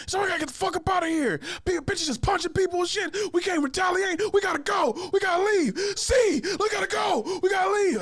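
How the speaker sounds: high-pitched, shouted panicky urging; pissed-off homie. Retold or imitated. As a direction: act out a worked-up friend desperate to leave. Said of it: "my homie is pissed".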